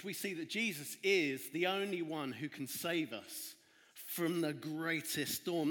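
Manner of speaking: slow, deliberate statement of the main point; slow emphasis. Shown as a demonstration. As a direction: lay out each word slowly. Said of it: "That's our big idea today".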